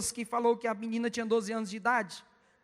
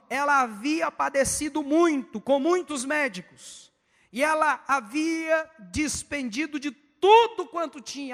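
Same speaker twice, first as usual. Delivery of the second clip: raised, slowed, emphatic verse reading; scripture reading; 3 semitones higher, 4 dB louder, slower than usual. Real, not acted. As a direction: proclaim the verse higher and drawn out. Reads scripture aloud emphatically. (leitura bíblica enfática e arrastada)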